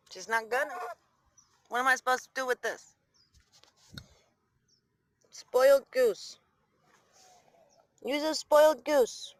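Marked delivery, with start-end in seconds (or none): 5.3-9.3 slow, loud teasing of a pampered bird. Real, not acted. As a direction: drag out spoiled.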